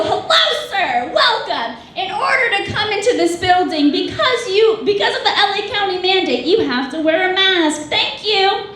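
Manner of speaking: high, singsong customer-service voice; overly polite. Acted. Hostess explaining mask mandate to customer, overly polite. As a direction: recite it with exaggerated hostess cheer.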